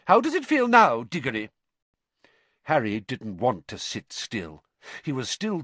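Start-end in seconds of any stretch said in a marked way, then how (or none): 0.1-1.5 high, loud call to the next cubicle; calling next door. A narrator's reading. Nurse calling to Diggory next door, loud. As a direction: call through the tent wall.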